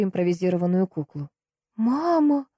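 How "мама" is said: high-pitched cry of horror; acted yell. A narrator's reading. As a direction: cry out in panic, pitch jumping up. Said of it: "заорала я".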